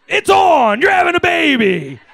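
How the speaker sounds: over-the-top action hero proclamation; Duke Nukem style announcer yell. Retold or imitated. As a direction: bellow it like a video game announcer. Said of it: "is this the Duke Nukem pregnancy test".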